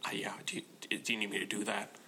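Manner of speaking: whispered gravelly mumble. Retold or imitated. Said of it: "and he's like".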